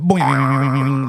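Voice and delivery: high springy boing noise; cartoon boing. Answering what noise it would make, cartoon boing. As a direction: make a comic spring sound. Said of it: "I'd be like that".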